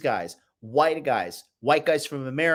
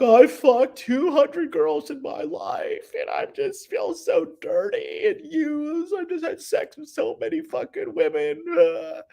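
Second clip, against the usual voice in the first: much higher, slow whiny mock sob; mock crying man; 13 semitones higher than usual. Mocking a man crying about his body count, high whiny sob. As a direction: whine it like a man weeping over his past, hammed-up. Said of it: "crying, like".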